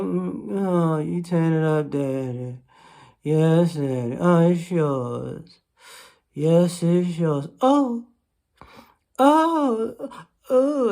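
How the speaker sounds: low, slow hammed-up bedroom moans; mock moaning woman. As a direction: play it as an over-the-top sultry woman. Mocking woman in bed.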